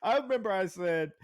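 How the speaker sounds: ordinary talk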